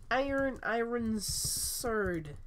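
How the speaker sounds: very slow, stretched-out words; slow-motion echo of a shout; playful drawl. Pretend. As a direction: drag each word right out. Playing with the phrase just shouted.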